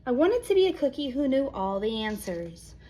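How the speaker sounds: plain reading voice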